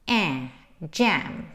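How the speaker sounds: eh, then jam, both with the a of cat; open A. Shown as a demonstration. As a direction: open wide and tense the vowel in jam. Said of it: "jam is with an open A".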